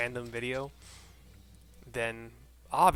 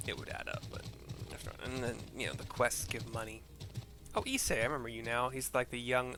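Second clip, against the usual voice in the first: usual speaking voice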